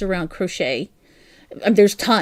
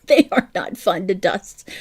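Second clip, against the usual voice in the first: laughing; 9 semitones higher than usual. Real, not acted. Laughing through a teacup story.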